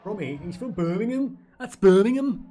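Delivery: high, exaggerated Birmingham accent with a laugh; mock Brummie accent. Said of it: "What is Brummy?".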